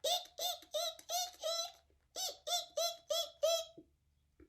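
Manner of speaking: very high squeaky monkey calls; high-pitched playful eek eek. Acted. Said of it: "What does the monkey say?".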